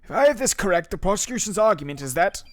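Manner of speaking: game lawyer. Acted.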